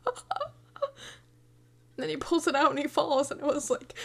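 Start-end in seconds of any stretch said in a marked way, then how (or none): 0.1-0.5 high, soft squeak of distress; a tiny pained squeal; mock squeal. Watching Tony pull the knife out, a soft squeal.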